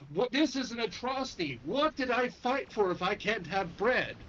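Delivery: high-pitched, wound-up joke rant; indignant outburst. Acted. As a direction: act out an outraged old comrade losing it. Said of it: "And he just loses it".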